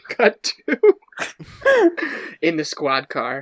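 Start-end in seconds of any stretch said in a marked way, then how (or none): 1.2-2.4 high laugh bursting out. Real, not acted. Cop player laughing while cutting to the squad car. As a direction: crack up mid-narration. Narrator cracks up.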